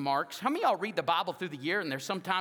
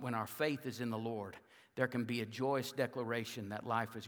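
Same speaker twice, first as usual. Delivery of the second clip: soft closing summary; 7 semitones lower, 5 dB quieter than usual. Acted. Pastor softly summing up the sermon's symbols, low and quiet. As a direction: lower and softer, winding down.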